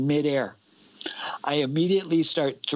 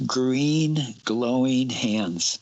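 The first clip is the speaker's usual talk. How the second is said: slow eerie; much slower than usual. Retold or imitated. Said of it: "and he said".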